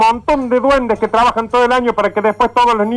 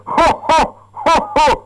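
hearty ho ho ho laugh, pitched up; Santa ho ho ho; 6 semitones higher, much slower than usual. Acted. Papá Noel's ho ho laugh.

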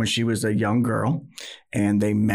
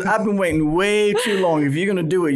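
mock indignant insistence; 7 semitones higher, faster than usual. Acting wife insisting on real proposal.

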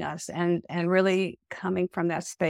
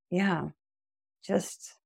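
ordinary delivery

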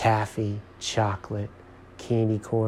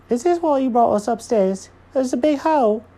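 loud, very high complaining voice; indignant Jenkins character; 14 semitones higher, 7 dB louder than usual. A narrator's reading. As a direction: complain loudly in a high cartoon voice.

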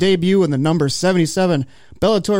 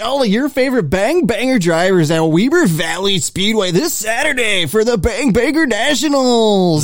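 monster truck ad; 4 semitones higher than usual. Pretend. Racing ad read on podcast.

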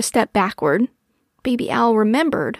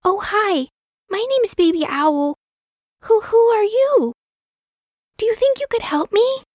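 put-on high, tentative child voice; friendly baby owl; 12 semitones higher than usual. A narrator's reading. Hurt baby owl meeting a pink nose, tentative. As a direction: introduce yourself shyly, pitched high.

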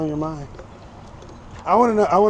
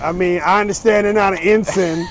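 louder, digging in on the point; stubborn loud retort; 4 semitones higher, 7 dB louder than usual. Real, not acted. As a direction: shout it back like you won't budge. Heated argument about navy ranks.

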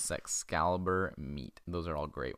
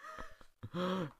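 higher giggle at silly words; amused laugh; 11 semitones higher, 5 dB quieter than usual. Real, not acted. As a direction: break into a high laugh.

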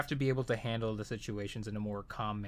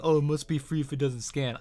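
mocking customer; 3 semitones higher, 4 dB louder than usual. Mocking customers' stock line.

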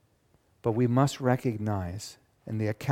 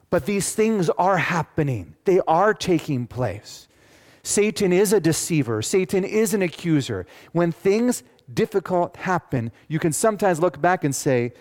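loud, higher, driving preacher read; preaching scripture; 7 semitones higher, 5 dB louder than usual. Acted. Pastor booming out God's words to the congregation. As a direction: proclaim it to the congregation.

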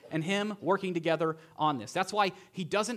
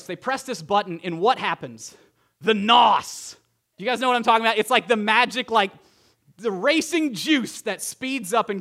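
fired-up preaching; 2 semitones higher, 6 dB louder than usual. Acted. Preaching builds to climax.

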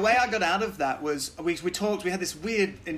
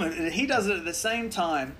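normal talking voice

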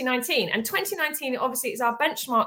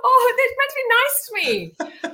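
high, laughing mock whimper; mock hurt; 11 semitones higher, 4 dB louder than usual. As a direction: playfully wail as if picked on. Mock hurt after being teased.

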